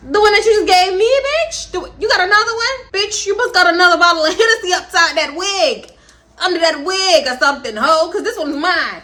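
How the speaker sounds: high, loud, mock outraged comeback; indignant retort. Friend trying to trade away her bottle, mock outrage. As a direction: snap back in mock outrage. Angry retort over the Hennessy.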